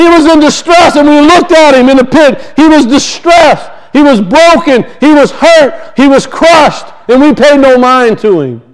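rising sermon build; preaching. Acted. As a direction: hammer each line of the list.

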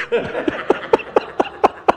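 quiet unvoiced laugh at the joke; breathy laugh. Real, not acted. Preacher laughing at the Blister nickname, breathy laugh. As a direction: let the laugh escape without voice.

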